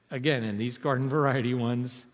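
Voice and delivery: normal talking voice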